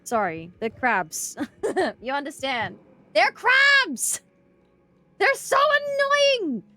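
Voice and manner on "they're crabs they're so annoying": high, loud, drawn-out complaint; annoyed yell. Real, not acted. Streamer complaining to chat about crabs, loud annoyed yell. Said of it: "Sorry, I'm yelling".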